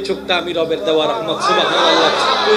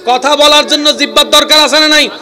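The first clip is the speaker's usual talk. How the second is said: high, loud shouting sermon; fiery preacher at full voice; full-force preaching; 7 semitones higher, 7 dB louder than usual. Acted. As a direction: hammer each point to the crowd.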